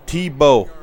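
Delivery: loud Chicago-accent TEE-bow; calls out "Tebow" with a hard Chicago accent. Shown as a demonstration. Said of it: "My Chicago accent".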